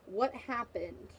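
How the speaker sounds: usual speaking voice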